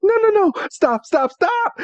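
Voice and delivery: high-pitched panicked pleading, played for laughs; mock panic. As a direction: plead in comic alarm as you're dragged off. Acts out Misty's panicked protest.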